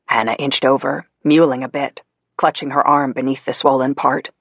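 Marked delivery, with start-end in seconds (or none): none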